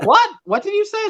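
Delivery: high-pitched mock bafflement; baffled reaction. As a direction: squeak out your confusion, acted. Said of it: "And I'm like".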